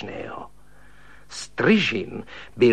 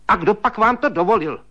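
high, louder stern scolding; sharp, high reprimand; 9 semitones higher, 4 dB louder, faster than usual. Acted. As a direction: tell him off like a strict old maid. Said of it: "spustila přísně švagrová".

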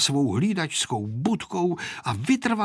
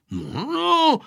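shouting into an ear; 12 semitones higher than usual. A narrator's reading. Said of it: "křičel mladý pilot dědečkovi do ucha". (křičet dědovi do ucha)

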